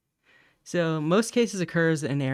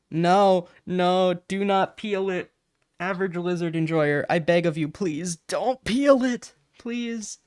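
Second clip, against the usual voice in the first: high, slow, begging plea; no no, please don't peel it, in a high wailing plea; dramatic pleading; 6 semitones higher, 4 dB louder, slower than usual. Acted. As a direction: beg chat like it's life or death, drawing it out. Pleading with chat not peel.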